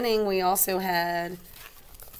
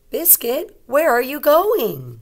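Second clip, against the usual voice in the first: higher, slow and louder call; calling the puppy; 8 semitones higher, 7 dB louder, slower than usual. Acted. Little girl in the story calling after Biscuit.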